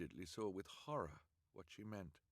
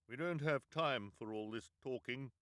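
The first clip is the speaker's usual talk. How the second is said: loud, high disguised voice; 6 semitones higher, 8 dB louder than usual. Acted. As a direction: bark it to hide your voice. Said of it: "hoping they wouldn't recognize his voice".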